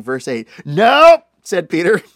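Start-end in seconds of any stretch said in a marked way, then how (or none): 0.7-1.2 loud, high blunt "Nope"; flat refusal. Acted. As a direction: shut it down on the spot. Peter refuses Jesus.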